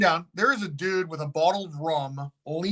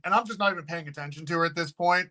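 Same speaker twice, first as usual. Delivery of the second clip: usual speaking voice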